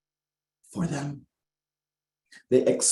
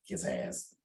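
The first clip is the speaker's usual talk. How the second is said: whispered low aside, barely voiced; muttered aside; 8 semitones lower, 11 dB quieter than usual. Real, not acted. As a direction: drop it to a breathy mutter.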